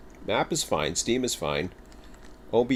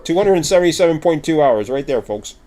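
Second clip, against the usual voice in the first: talking with a mouthful of food; mouth full; 6 semitones higher, 7 dB louder than usual. Real, not acted. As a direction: say it through a bite of cereal bar. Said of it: "Sorry for talking from my mouthful".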